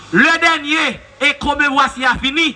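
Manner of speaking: ordinary talk, nothing marked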